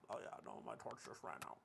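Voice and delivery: breathy whisper to himself; muttered under the breath; whispered aside. Real, not acted. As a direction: drop to a whisper, disappointed.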